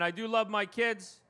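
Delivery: normal talking voice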